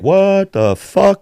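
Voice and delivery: high, drawn-out bewilderment; stunned disbelief. Retold or imitated. Man recalling the hum suddenly stopping, stunned disbelief.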